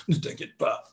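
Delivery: breathy frightened whisper; whispered, frightened "Ne t'inquiète pas"; mock trembling whisper. Shown as a demonstration. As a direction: whisper it as if scared yourself. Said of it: "ça ne fonctionne pas".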